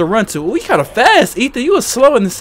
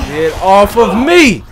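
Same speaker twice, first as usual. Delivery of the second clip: panicked shout; 8 dB louder, slower than usual. Real, not acted. Monster grabbing him.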